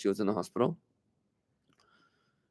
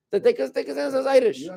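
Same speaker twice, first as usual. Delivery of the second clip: much higher, mocking; mock claim; 10 semitones higher than usual. Teacher to class, mocking their Irish claim in a high voice. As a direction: toss it off as if it were silly.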